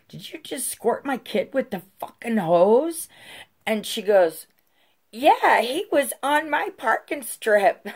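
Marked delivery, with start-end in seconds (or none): 0.1-3.1 high, incredulous retold demand; outraged confrontation. Mom confronting the neighbor about the hose, outraged. 5.1-7.9 loud, high mocking imitation; defiant neighbor. Neighbor justifying squirting the kid, mocked as defiant.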